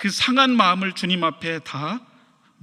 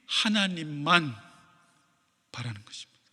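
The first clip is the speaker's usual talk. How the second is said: hushed; 5 semitones lower, 8 dB quieter than usual. Shown as a demonstration. Preacher slowing to drive home the point, hushed. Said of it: "repeated, hushed at the end".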